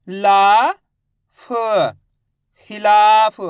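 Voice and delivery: loud stretched ला, separate फ, then drawn-out खिलाफ; syllable-by-syllable reading. Shown as a demonstration. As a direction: sound out खिलाफ for learners, piece by piece. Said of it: "एक अक्षर का उच्चारण करके इनको पढ़ रहा हूँ". (ला, फ अलग-अलग, फिर खिलाफ खींचकर ज़ोर से)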